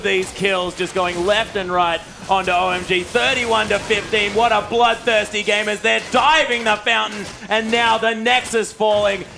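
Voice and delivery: loud, high game-winning call; excited shout. Real, not acted. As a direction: scream the finish like a final whistle. Game-ending teamfight, Nexus falls.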